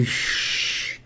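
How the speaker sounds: unvoiced shush; a soft, sharp hush; whispered shh to silence him. Creepy kid hushing him.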